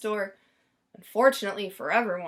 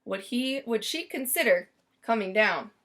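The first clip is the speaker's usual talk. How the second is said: ordinary delivery, nothing marked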